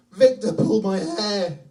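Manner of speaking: loud, high, drawn-out imitation wail; hammed-up tearful child complaint; crying child. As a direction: wail like a hurt little boy. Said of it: "He's crying".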